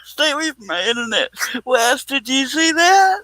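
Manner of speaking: high, laugh-choked mock threat; quoting a threat through helpless giggles; losing it laughing. Real, not acted. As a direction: squeal the warning while cracking up. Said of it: "[name]'s losing it".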